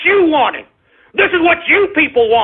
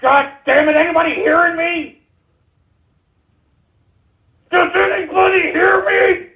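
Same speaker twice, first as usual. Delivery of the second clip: furious shout; 7 semitones higher than usual. Real, not acted. Host shouting at an audience that won't answer, furious shout. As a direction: roar it into the void.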